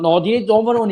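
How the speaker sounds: re-enacted protest. Fan retelling his question to the guy next to him, re-enacted protest. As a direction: play back your agitated question.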